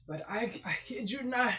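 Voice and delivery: ordinary talk, nothing marked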